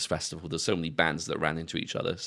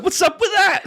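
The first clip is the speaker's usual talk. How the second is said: high, loud Long Island mimicry; teasing Long Island impression; mock Long Island; 10 dB louder than usual. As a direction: parrot it back in a Long Island accent. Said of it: "You sounded, you sounded so Long Island there".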